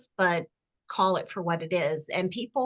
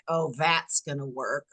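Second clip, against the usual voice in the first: snarky; 6 semitones lower than usual. Retold or imitated. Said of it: "somebody is snarky".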